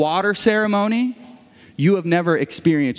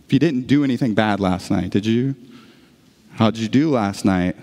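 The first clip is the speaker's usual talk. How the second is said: low, doubtful probing; mock suspicious questioning; 8 semitones lower than usual. Pastor as a reluctant Jesus vetting sinners, mock suspicion.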